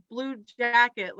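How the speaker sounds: ordinary delivery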